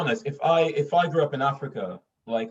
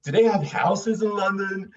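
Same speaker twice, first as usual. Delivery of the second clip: high, loud, drawn-out mimicry; hammed-up, slow astonished question; incredulous American questioner; 8 semitones higher, 7 dB louder than usual. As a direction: imitate a stranger slowly marvelling. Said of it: "he generally asked me these questions".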